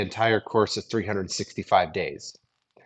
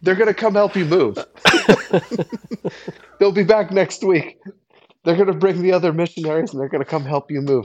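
higher, louder, quick proud announcement; lightly voiced gleeful [name]; 5 semitones higher, 3 dB louder, faster than usual. [name] bragging to his friend about recruiting the missionaries, quick and gleeful. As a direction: rattle it off pleased with yourself.

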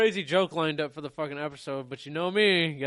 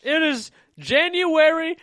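mock voice; 11 semitones higher, 8 dB louder, slower than usual. Mocking the Christmas idea.

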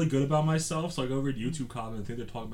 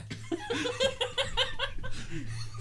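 high laugh. Real, not acted. Laughing at the rabbi joke.

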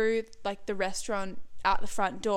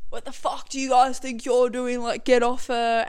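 loud, raised-pitch mock confrontation; acted-out angry challenge, louder; retold angry intervention; 3 semitones higher, 6 dB louder than usual. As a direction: imitate someone storming in to break up a fight. Kitchen hand breaking up a fight.

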